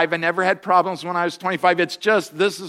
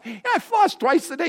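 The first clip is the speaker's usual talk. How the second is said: mock-proud, pitched way up; high proud reply; 10 semitones higher than usual. Pastor retelling his reply to the dentist, high and smug. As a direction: pipe up smugly with it. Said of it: "He says".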